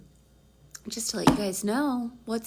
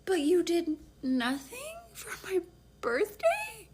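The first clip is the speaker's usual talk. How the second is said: high-pitched wounded question; hurt disbelief; 8 semitones higher, slower than usual. Retold or imitated. Woman asking boyfriend about forgotten birthday, hurt disbelief.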